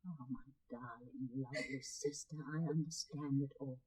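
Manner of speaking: tender comfort. Acted. Comforting her sister.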